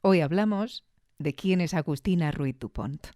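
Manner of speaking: usual speaking voice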